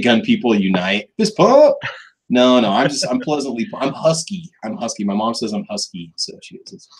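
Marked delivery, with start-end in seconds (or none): none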